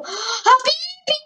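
high, slow shriek of Habibi!; shrieked imitation. Mimicking the game character's cry Habibi!, high shriek. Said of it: "Die schreit Habibi!".

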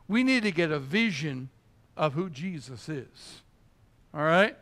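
ordinary talk, nothing marked

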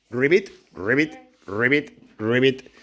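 frog croak. Shown as a demonstration. Teacher imitating a frog for class.

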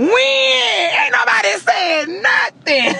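drawn-out high wail of protest; playful outraged wail; whiny protest. Real, not acted. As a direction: stretch it out in a high, loud mock cry. Teasing friend about Texas trip.

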